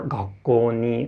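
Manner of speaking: "gakkou ni" slow, first mora low, the rest and "ni" high; low-high pitch accent. Shown as a demonstration. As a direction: carefully demo "gakkou ni" low then high. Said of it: "first pitch is low and all the remaining pitch are high".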